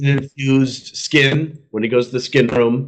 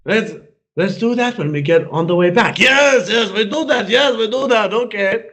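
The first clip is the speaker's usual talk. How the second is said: high put-on foreign voice, pleased with themselves; hammed-up scheming Turks, higher; mock foreign accent; 7 semitones higher than usual. Turks' dialogue acted in character.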